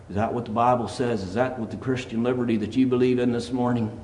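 normal talking voice

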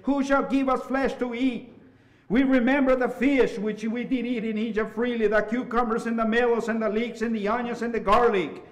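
high, pleading scripture quote; complaining lament. Retold or imitated. Israelites weep to Moses for flesh, complaining lament.

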